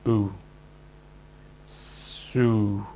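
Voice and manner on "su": "su" with S blended straight into the vowel. Shown as a demonstration. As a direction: glide "su" together seamlessly. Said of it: "blend the two sounds together until they are seamless".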